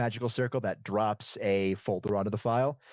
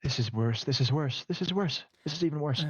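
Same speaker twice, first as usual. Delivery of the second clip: repeated worry climbing in pitch; acted fretting, ever higher; mounting panic; 5 semitones higher than usual. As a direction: let the alarm rise with each repeat. [name] reacts in mounting alarm.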